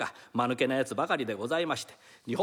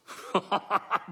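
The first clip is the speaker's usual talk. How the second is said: laughing; 3 semitones higher than usual. Real, not acted. Performer breaks into laughter.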